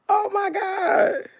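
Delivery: slow, high exclaim of disbelief; hammed-up slow gasp of shock; mock exclamation. Reacting to the thief.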